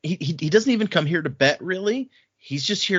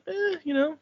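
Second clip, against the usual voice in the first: much higher, coy offhand shrug; 4 dB quieter than usual. Retold or imitated. Shallan shrugging off her brother's question, high and coy. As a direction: brush it off playfully.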